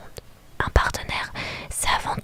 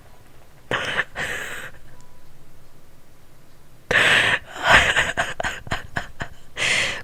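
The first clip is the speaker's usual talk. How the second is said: wheezy, breathless laughter; long laughing fit. Real, not acted. Player losing it over the suitor, helpless laughing. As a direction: laugh helplessly until out of air.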